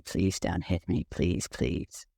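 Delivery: begging quietly through imagined tears; retold crying plea. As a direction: let the plea crumble into a tearful hush. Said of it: "just starts to cry and says".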